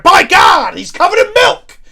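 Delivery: high, loud commentator shout of shock; scandalised play-by-play holler; wrestling commentator outburst. Pretend. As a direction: yell it like a stunned ringside commentator. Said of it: "the commentary".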